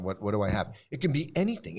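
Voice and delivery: normal talking voice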